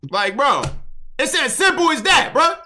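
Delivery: high-pitched, loud exasperated whine; worked-up protest in a high, strained yell; exasperated rant. Real, not acted. Ranting at chat's screenshot complaint.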